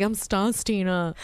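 slow, drawn-out mock introduction; imitated tone. Mimicking friend's introduction to the driver, slow drawn-out imitation. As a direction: mimic her, stretching out the name. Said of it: "but in that tone of voice".

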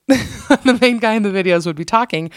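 talking through a real giggle; amused, laugh-tinged reading. Real, not acted. Laughs while reading husband's story.

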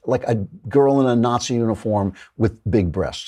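ordinary talk

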